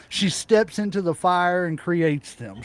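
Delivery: ordinary talk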